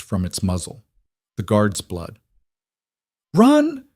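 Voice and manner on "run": desperate scream at full force. Acted. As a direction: scream it at the children. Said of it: "Elira screamed at the kids".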